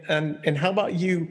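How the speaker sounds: normal talking voice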